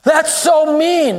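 high, drawn-out complaint; mock protest. Preacher mimics someone objecting to God's judgment, mock protest. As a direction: imitate an indignant objector, stretching the words.

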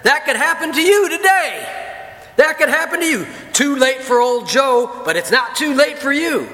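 loud, high hellfire preaching shout; fiery country preacher yelling at a funeral; preacher pounding the pulpit. Retold or imitated. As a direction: thunder it at the mourners from the pulpit. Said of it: "he said".

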